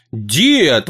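ordinary delivery

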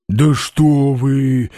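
slow, drawn-out exclamation in a character voice; exclaiming Balaganov. A narrator's reading. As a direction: stretch the words out in protest. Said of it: "воскликнул Балаганов". (протяжное восклицание)

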